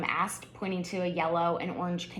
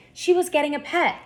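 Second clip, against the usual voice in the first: excited; 8 semitones higher, 7 dB louder than usual. A narrator's reading. Narrator building Lucy's excitement.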